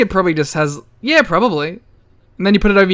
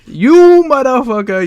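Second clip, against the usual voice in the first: higher, louder, slow hammed-up New York curse; mock New York accent; 7 semitones higher, 6 dB louder than usual. Ending the bit with a loud mock New York curse. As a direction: spit it like a cartoon New Yorker. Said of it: "doing terrible accents".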